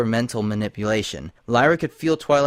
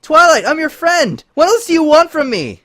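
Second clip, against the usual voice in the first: high, loud anguished pleading; desperate plea; 10 dB louder than usual. Acted. Lyra pleading with Twilight under the spell, anguished. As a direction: beg your friend to stop.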